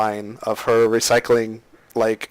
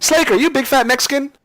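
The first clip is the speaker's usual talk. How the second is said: mock teasing; 12 semitones higher than usual. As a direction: tease a buddy in a high pitched yelp. Teasing friend on Facebook.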